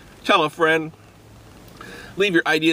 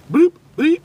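robot noises; 11 semitones higher, 5 dB louder than usual. Pretend. Imitating the robot characters.

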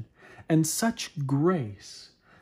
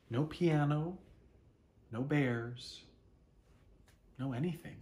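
softer with each phrase; storyteller sinking into dismay; fading disappointment; 4 dB quieter than usual. A narrator's reading. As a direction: let it fade to nothing. Empty clearing found.

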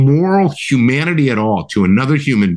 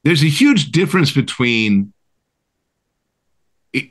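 ordinary talk

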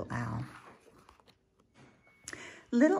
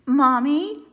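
put-on high, loud, rising child's call; calling little owl; 12 semitones higher, 9 dB louder than usual. Little Owl calls Mommy from bed, high and questioning.

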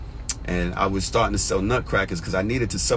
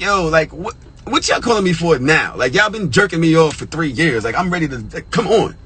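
louder, higher, fed-up protest; exasperated complaint; 6 semitones higher, 4 dB louder than usual. Retold or imitated. Applicant fed up after years of waiting, exasperated complaint. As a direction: push it out in pleading exasperation. Acts out exasperation at the late call.

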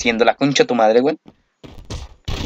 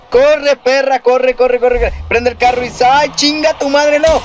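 urgent yelling; 9 semitones higher than usual. Real, not acted. Urging character, then dismay.

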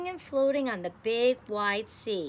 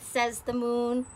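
ordinary delivery, nothing marked

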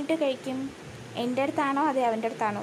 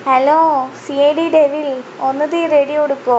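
raised voice; 2 semitones higher, 12 dB louder than usual. Real, not acted. Streamer calling to CID Devil after being told to speak up, raised voice. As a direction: call out loudly so they can hear. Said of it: "ഒച്ചത്തിൽ പറയണോ ഇപ്പോഴോ".

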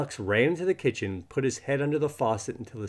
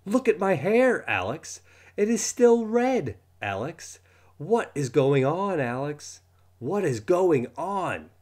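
high, loud frantic yell; 8 semitones higher, 5 dB louder, slower than usual. Acted. Boy yelling at his friend about his red hair. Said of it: "and yelled".

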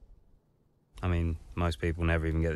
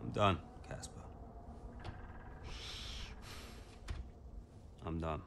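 hushed despair; 15 dB quieter than usual. Acted. Driver to team boss after crash, hushed and defeated. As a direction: barely voice it, drained.

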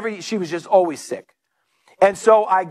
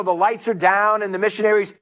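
ordinary delivery

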